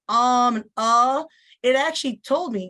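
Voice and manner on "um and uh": slow, drawn-out um and uh; deliberate fillers. Shown as a demonstration. Teacher demoing the fillers the app flagged, slow and hesitant. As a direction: stall on purpose. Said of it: "So when I said".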